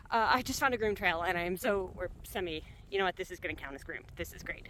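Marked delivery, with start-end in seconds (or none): none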